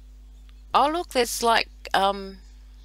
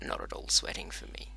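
very low, hushed aside; barely voiced, sheepish denial; muttered aside; 10 dB quieter than usual. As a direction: mutter it under your breath. Muttered retraction of the gloat.

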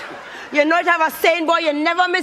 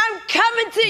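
put-on Yankee accent; 4 semitones higher than usual. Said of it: "Drop the accent now".

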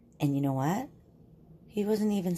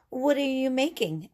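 curious dad; 8 semitones higher than usual. Acted. Dad asks Mole.

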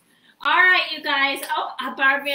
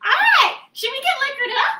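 excited granny whoop; 12 semitones higher, 9 dB louder than usual. Acted. Granny cheering for drinks.

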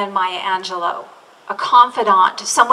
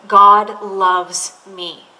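slow reading; 5 dB louder, much slower than usual. Retold or imitated. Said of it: "a line that says".

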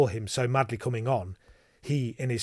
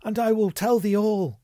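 high, louder damsel's voice, giving in; pleading cry; 10 semitones higher, 6 dB louder than usual. A narrator's reading. Damsel yields to the threatening knight, crying out. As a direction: plead at a much higher pitch. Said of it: "cried the damsel".

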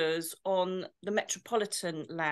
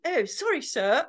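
higher, apologetic posh English; putting on posh; 5 semitones higher than usual. Retold or imitated. Officer apologising to the posh tramp, putting on posh. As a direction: copy his posh accent as you apologise. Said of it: "I started talking posh myself".

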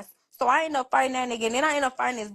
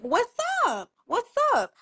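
retold challenge; 10 semitones higher, slower than usual. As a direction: throw the question like a dare. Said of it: "I'm, I'm like".

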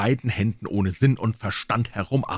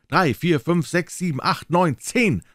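high, louder ringing count; tolling count; 8 semitones higher, 3 dB louder, slower than usual. Acted. Great bell counting strokes in a high ringing voice. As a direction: ring out each number like a bell.

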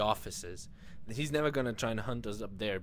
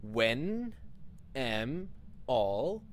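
slow halting reading; much slower than usual. Pretend. Struggling reader reads wall writing.

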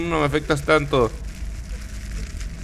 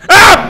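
real high, loud scream; sudden shriek at a jump scare; startled scream; 15 dB louder than usual. Real, not acted. Grabbed in a horror game.

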